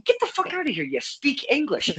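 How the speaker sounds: high-pitched incredulous retort; comic disbelief without shouting; retold disbelief. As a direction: act out being floored, voice shooting up. Retold disbelief at Russian lady's reply.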